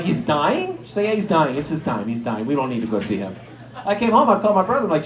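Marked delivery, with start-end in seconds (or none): 0.0-0.8 rising, disbelieving question; retold startled question; taken aback. Startled reply to grandma's news. 1.1-3.4 imitated grandma, dropping lower and softer; offhand, matter-of-fact, sinking to a casual low; lackadaisical. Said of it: "very lackadaisical about death".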